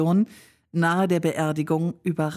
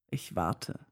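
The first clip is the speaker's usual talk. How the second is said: a hint of a grin, lower and softer; grinning reply; 8 semitones lower, 8 dB quieter than usual. He answers her teasingly, grinning, quietly. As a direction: let the grin sound through, lightly. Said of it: "antwortete er grinsend".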